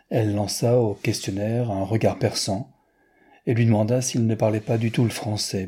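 plain reading voice

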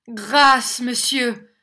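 high, loud plea for mercy; pleading cry. A narrator's reading. Victim begging the schoolmaster to spare him, pleading cry. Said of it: "cria Smith".

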